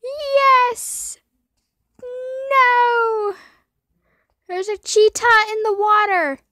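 high, loud excited yells; excited yelling. Real, not acted. Gamer yells at a cheetah in the water. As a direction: shout it out in disbelief.

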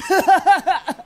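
high-pitched cackle at own pun; laughing. Real, not acted. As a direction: burst out in a long, high laugh. Laughs at own Santa hat pun.

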